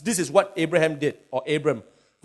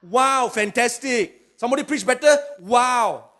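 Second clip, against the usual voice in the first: mock amazement; 6 semitones higher, 3 dB louder, slower than usual. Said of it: "mocks a fake-happy reaction".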